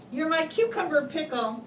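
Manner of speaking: ordinary delivery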